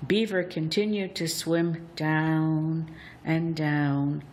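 ordinary delivery

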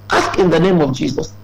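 ordinary talk, nothing marked

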